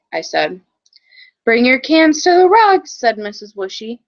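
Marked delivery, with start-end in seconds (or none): 1.4-2.9 loud, higher teacher call; calling the class. Acted. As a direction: call the class over to the rug.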